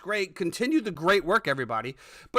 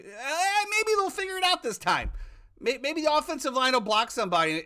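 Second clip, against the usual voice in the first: mock hopeful; 6 semitones higher than usual. Sarcastic hope about the offense.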